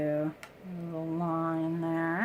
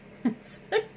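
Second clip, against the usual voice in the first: real airy giggle, much higher; breathy laugh. Real, not acted. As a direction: let a breathy laugh slip out. Laughing at gross-looking product.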